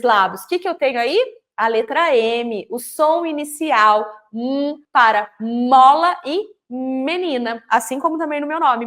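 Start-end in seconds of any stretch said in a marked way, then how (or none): 1.6-7.6 slow, deliberate. Shown as a demonstration. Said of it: "teacher stressing the M sound".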